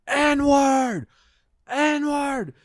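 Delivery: high, sustained, loud holler; imitated screaming. Storyteller imitating the man yelling on the stairs, screaming. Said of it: "he is just screaming it".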